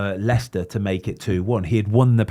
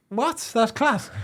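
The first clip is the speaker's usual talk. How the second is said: surprised; 12 semitones higher, much slower than usual. Retold or imitated. Surprised reaction retold.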